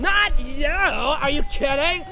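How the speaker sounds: loud, mocking bully; sneering stepsister. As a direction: jeer like a cruel sister. Stepsister scolds Cinderella.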